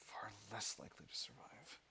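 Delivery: whispered reading of the options under his breath. Real, not acted. Player to himself, reading choices in a whisper. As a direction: breathe the words out with no voice.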